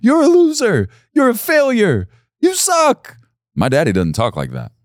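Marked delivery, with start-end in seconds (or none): 0.0-3.2 much higher, loud jeering taunt; mocking opposing team. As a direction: jeer like the rival bench. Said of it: "the other team is saying".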